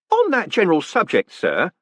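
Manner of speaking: high, bright-voiced jolly warder; cheerful warder. Warder reporting the prisoner's words to the condemned man, cheerfully. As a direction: play the polite prison warder chatting brightly.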